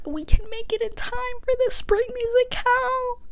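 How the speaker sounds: very high, put-on squeaky voice; mock-emotional squeak. Retelling Gabriella and Troy's reunion, mock-emotional squeak. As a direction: gush in a tiny, hammed-up high voice.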